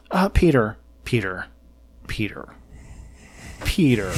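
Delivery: low, soft, patronizing repeats of the name; smug, condescending. Retold or imitated. Press secretary talking down to a reporter, smug and condescending. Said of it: "smug, condescending tone to him".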